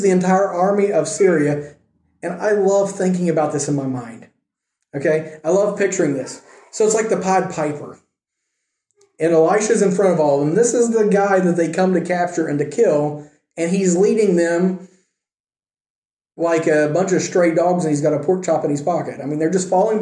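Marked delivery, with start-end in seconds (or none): none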